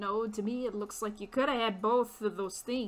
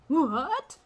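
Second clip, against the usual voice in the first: louder gasping disbelief, What and My daughter; a father hit by terrible news, higher and louder; shocked father; 4 semitones higher, 7 dB louder than usual. Acted. Father hears daughter kidnapped.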